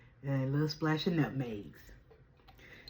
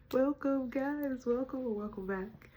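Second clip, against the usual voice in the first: excited greeting; 9 semitones higher, slower than usual. Acted. Opening greeting pitched up.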